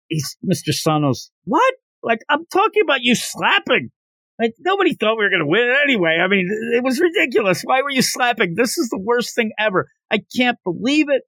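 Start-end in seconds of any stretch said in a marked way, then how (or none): 1.4-11.2 high-pitched, exasperated rant; mock yelling. Coach scolds the boy for slapping, high mock yell. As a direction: imitate a coach losing it, pitch way up. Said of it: "just starts yelling at him".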